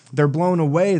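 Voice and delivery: usual speaking voice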